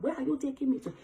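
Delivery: high, urgent retold screaming; screaming at the driver. Passenger screaming at a speeding taxi driver, high and frantic. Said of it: "I was screaming, like".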